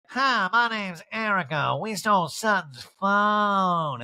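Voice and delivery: high, slow, singsong put-on voice; Erica. Housewife taunting a caller on a stolen phone, teasing drawn-out impression. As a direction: stretch the words out high and teasing.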